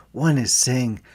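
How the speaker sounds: ordinary delivery, nothing marked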